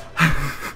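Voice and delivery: put-on awkward teenager-like laugh; demo of own laugh. Shown as a demonstration. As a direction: let out your awkward laugh to show it. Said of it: "That's me laughing, okay? I laugh like".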